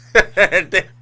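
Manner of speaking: loud high laugh; burst of laughter. Real, not acted. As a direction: crack up. Laughing at his blunder.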